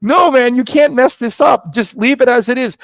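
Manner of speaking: high, loud protest to a buddy; emphatic insistence. Retold or imitated. Telling his buddy not to mess it up, insistent. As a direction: plead loudly to leave it alone.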